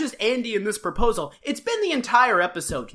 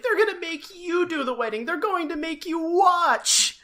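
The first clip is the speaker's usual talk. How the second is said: a pitched-up, frantic warning; frantic Barney again; 8 semitones higher than usual. Retold or imitated. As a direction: flail and plead. Barney flailing his arms.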